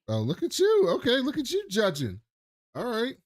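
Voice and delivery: high, sing-song teasing of a friend; playful teasing. Real, not acted. Friend teasing the other for judging someone, high playful ribbing.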